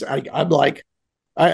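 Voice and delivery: ordinary talk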